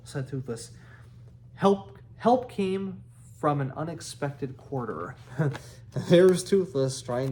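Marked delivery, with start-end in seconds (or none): none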